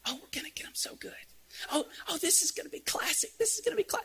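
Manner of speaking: high, hushed, gleeful scheming; girls' sneaky whisper. Acted. Girls creeping downstairs plotting to scare the boys, sneaky whisper. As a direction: whisper like kids plotting a prank.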